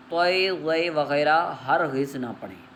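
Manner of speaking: ordinary talk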